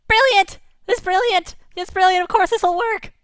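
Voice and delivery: high, loud squealing delight; the little fish go wild over the plan; tiny fish shrieking with joy; excited cheer. Acted.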